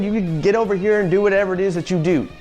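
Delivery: ordinary talk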